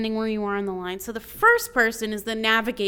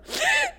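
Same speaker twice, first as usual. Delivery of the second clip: a high, sobbing gasp for air; sharp gasp; 3 dB louder than usual. Retold or imitated. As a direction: catch your breath mid-sob. Retelling her own crying on the hike.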